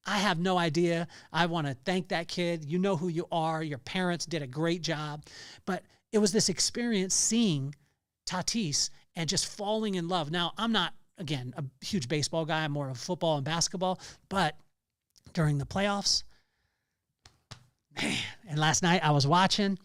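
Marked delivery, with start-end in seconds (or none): none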